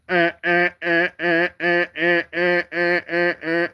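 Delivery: high chirping bird calls, repeated; squawking bird call; imitating a bird. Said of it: "a bird starts going".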